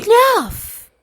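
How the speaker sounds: high, loud stop; sharp shout. Acted. Mother silences her excited son with a loud shout. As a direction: cut him off hard.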